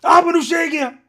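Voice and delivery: high, loud teasing threat; brother's taunt. Retold or imitated. Twin brother loudly threatening to tell on him. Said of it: "and he's like".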